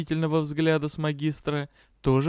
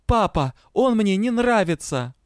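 high, loud protest to father; yelled complaint; 7 semitones higher, 5 dB louder than usual. A narrator's reading. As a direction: holler your objection to dad. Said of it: "а Заэль продолжила".

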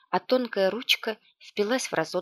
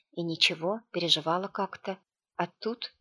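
usual speaking voice

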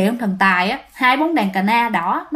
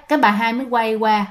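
plain reading voice

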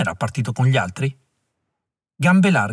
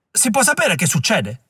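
higher, piercing demand; shrill yell; 7 semitones higher than usual. Acted. Klug demands to know what is happening, shrill yell. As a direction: shriek the question in alarm. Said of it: "strillava Klug".

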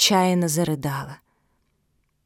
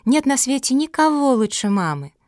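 high, ardent declaration of love; glowing, raised voice; fervent; 7 semitones higher, 4 dB louder than usual. A narrator's reading. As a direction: insist with childlike passion. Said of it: "с жаром воскликнула дворняжка".

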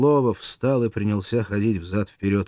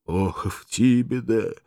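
slow, tender, full of love; tender loving voice; 6 semitones higher, 4 dB louder, slower than usual. Acted. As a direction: sigh her name lovingly. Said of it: "нежным, полным любви голосом".

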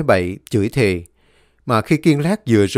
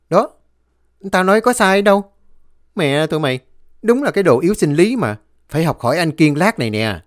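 higher-pitched smug boasting; cocky; 6 semitones higher than usual. A narrator's reading. Kiên gloating to his friends after starting the bike, cocky. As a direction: crow over your two mates. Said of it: "rồi nói tinh tướng".

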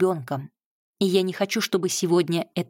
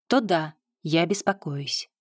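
ordinary delivery

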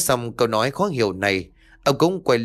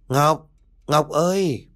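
calling out; 4 semitones higher, slower than usual. Acted. Mother calling her daughter in the dark house, drawn-out call. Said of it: "bà vừa cất tiếng gọi".

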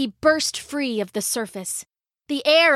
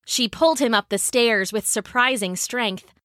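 usual speaking voice

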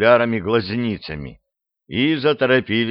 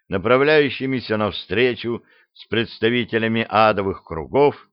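usual speaking voice

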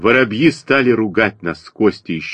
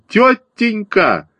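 high, drawn-out cry; yelling; 12 semitones higher, much slower than usual. A narrator's reading. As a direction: call out high, stretching the word. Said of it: "Костя заорал". (протяжный высокий выкрик)

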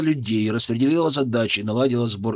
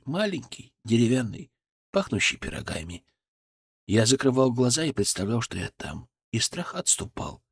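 soft, fading soothing voice; soft spa whisper; 3 dB quieter than usual. Acted. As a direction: lull her gently into calm. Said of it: "мягким, успокаивающим голосом".

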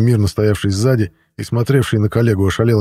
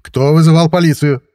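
louder, higher police demand; raised voice; 6 semitones higher, 5 dB louder than usual. A narrator's reading. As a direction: demand an answer from the whole room. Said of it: "повысил голос полицейский". (повышенный голос, требовательный вопрос)

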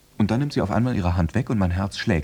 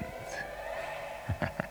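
breathy whispered laugh; 13 dB quieter than usual. Acted. After whispering about the hand.

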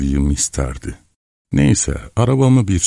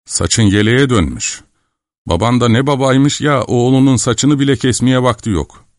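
higher, indignant mother's voice; mildly angry scolding; 7 semitones higher than usual. A narrator's reading. Mother scolding her shaggy-haired son, mildly angry. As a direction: keep telling off, pitched up, lightly shouted. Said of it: "diye bağırdı".

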